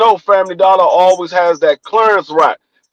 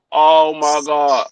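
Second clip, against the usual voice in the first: exasperated sigh; 4 semitones lower, slower than usual. Retold or imitated. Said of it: "I'm like".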